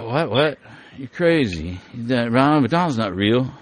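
lightly acted disbelieving objector; mock incredulous questioning. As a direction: object as if they must be crazy. Imagined disbelieving listener objects.

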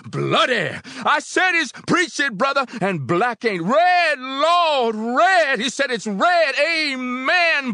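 ecstatic high, loud call-and-response; shouted preaching. A narrator's reading. Preacher and congregation trade calls, shouting. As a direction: whip up a church at full cry. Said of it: "the preacher shouted".

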